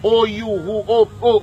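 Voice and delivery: ordinary delivery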